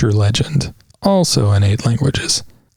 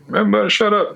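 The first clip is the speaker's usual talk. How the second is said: mock bossy command; 8 semitones higher, much faster than usual. Leader of the conversation telling everyone to shut up, mock bossy. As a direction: playfully bark it as the boss of the chat. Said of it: "You can be like".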